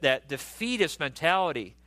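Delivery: ordinary delivery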